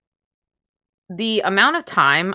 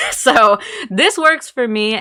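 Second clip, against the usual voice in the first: laughing; 5 semitones higher, 4 dB louder, slower than usual. Real, not acted. Laughing at her own oddness.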